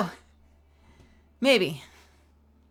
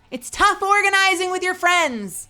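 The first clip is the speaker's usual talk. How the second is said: mock lament; 10 semitones higher, 5 dB louder than usual. Exaggerated complaint.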